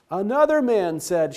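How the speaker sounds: usual speaking voice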